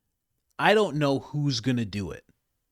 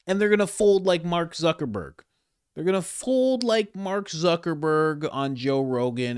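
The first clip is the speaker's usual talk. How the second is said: louder and higher, stretching the word fold; mocking jab; 7 semitones higher, 6 dB louder than usual. Real, not acted. Mocking senators folding under pressure, loud and jeering. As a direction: sneer at the cave-in.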